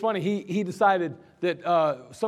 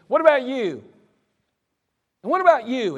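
loud, high accusing questions; angry sergeant; 8 semitones higher, 9 dB louder than usual. Retold or imitated. Sergeant going down the line demanding excuses, loud and angry. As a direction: point at each soldier in turn.

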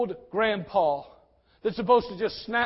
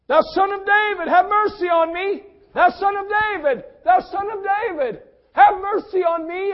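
a high, loud desperate cry for mercy; 10 semitones higher, 6 dB louder than usual. Acted. Blind beggar shouting to Jesus, high and desperate. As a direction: call out over the crowd to someone unseen. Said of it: "And he begins to cry".

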